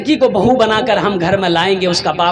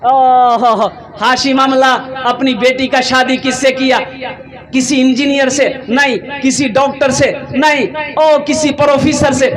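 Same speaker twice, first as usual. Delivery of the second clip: raised, ringing sermon delivery; impassioned preaching; 6 semitones higher than usual. Acted. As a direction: fire off questions and answers to rouse the crowd. Preaches at full force.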